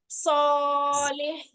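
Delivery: सॉलेह slow with a heavy full सॉ; bold saad. Shown as a demonstration. Teacher modelling सॉलेह, slow and bold. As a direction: draw out a heavy सॉ. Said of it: "सौद है हमारे पास आगे".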